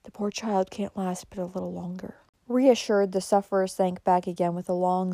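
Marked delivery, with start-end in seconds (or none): 0.0-2.2 very soft, lowered murmur; whispering aside. A narrator's reading. Nurse adds aside to Amy, whispering. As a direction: confide it under the breath. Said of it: "whispering to Amy, she added".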